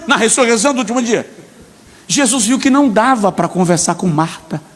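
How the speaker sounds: ordinary delivery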